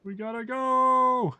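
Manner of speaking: high, slow, drawn-out shout; urgent yell. Real, not acted. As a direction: yell it out in panic. Fleeing enemies.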